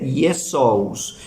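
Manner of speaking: Jesús said slowly as "ee-eh-soos"; Jesús as the Greek Iesous; Greek pronunciation. Shown as a demonstration. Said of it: "en griego se dice".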